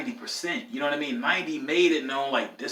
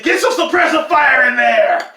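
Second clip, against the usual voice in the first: loud barking drill sergeant order; mean drill sergeant yell; 10 semitones higher, 14 dB louder than usual. Retold or imitated. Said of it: "He said it so mean".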